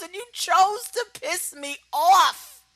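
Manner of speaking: a villain's cold final threat; menacing payoff. Retold or imitated. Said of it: "And Roman goes".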